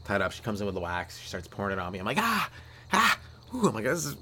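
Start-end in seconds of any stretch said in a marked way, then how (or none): 2.2-3.7 high pained ah and ooh; mock pain moans. Hot wax pain. 3.9-4.2 high strained complaint; mock pained. In pain from wax.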